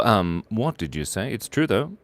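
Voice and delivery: usual speaking voice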